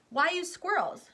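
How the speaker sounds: high, loud, slow challenge; challenging question. Acted. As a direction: question it bluntly. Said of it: "Mike T V asked".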